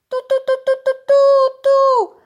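very high, loud toots; imitated whistle blasts. A narrator's reading. Tola blows the alarm whistle, high loud toots. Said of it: "i zaczęła gwizdać".

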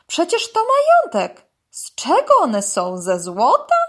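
loud, incredulous protest, starting very high; astonished. A narrator's reading. Dog balks at the python's price, astonished. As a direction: exclaim at the outrageous price, then question it. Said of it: "powtórzył zdziwiony Urwis".

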